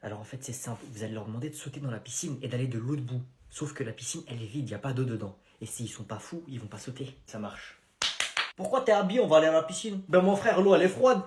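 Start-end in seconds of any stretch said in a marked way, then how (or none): 0.0-7.8 parler très bas, à voix basse; chuchoté en complot. Acted. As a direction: comploter en secret. Conversation secrète chuchotée. (deux profs qui complotent tout bas)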